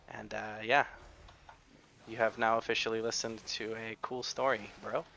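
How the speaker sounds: normal talking voice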